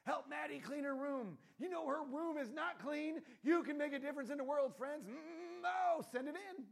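hammed-up charity appeal; mock TV fundraiser. Maddie on TV begging funds, hammed-up telethon. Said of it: "I don't think she actually talks like that".

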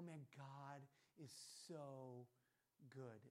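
low, soft and slow wonder; hushed awe. Retold or imitated. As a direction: let it sink in reverently. Retold awed reaction to miracle.

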